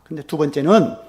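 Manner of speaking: ordinary talk, nothing marked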